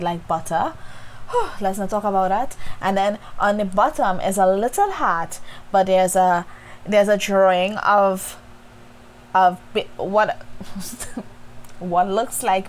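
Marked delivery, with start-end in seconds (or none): 0.8-1.6 short high breathy sigh of delight; melting sigh. Real, not acted. Teacher recalling the I love you note, melting sigh. Melted like butter. 10.5-11.7 a quiet airy chuckle; soft breathy laugh. Real, not acted. Teacher amused by a stick-figure drawing, breathy chuckle.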